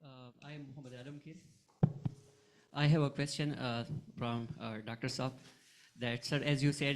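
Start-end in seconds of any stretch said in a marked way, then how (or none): none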